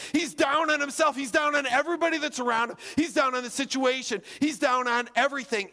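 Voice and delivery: high, driving list, rapid repeats; forceful preaching. Real, not acted. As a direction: hammer out each repetition like a preacher.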